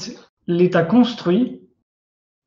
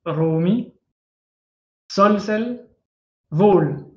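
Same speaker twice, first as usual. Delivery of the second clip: "Romi", "sol, sel", "vol" as separate model words, stressed at the start; first-syllable stress; much slower than usual. Shown as a demonstration. Teacher repeating Coptic examples, "Romi, sol, sel, vol" stressed on the first syllable. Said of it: "on accentue la première syllabe".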